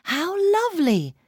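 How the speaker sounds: high, swooping exclamation of delight; exclaiming. A narrator's reading. English person admiring everyday things, exclaiming. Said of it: "this very strange custom of exclaiming".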